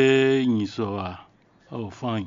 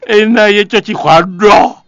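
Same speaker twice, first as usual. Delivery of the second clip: excited; 8 semitones higher, 11 dB louder, slower than usual. Real, not acted. Host yelling joke about screen room.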